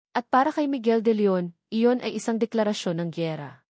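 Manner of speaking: ordinary delivery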